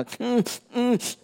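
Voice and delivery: put-on high hummed whimpers of a crying child; mock child crying hum; imitated child whimper. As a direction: mimic a little kid sobbing in hums. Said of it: "you know how children do that".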